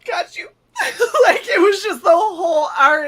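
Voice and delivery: high, loud laughing sob; choked up and laughing at once; laughing through tears. Real, not acted. Moved by the instructor's praise, laughing through tears. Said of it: "he was super emotional".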